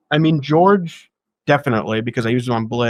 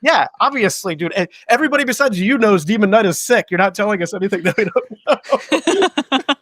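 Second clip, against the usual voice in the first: mock exasperated retort; 9 semitones higher than usual. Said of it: "And I'm like".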